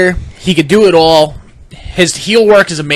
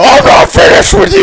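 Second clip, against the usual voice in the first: high, booming shout; loud mock shout; 7 dB louder than usual. Wrestler roaring at his daughter's date, loud mock shout. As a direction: yell it like an enraged giant. Acting out Strowman yelling.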